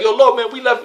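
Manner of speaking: high, loud exasperated plea to the Lord. Retold or imitated. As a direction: whine it like a fed-up disciple. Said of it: "he got frustrated".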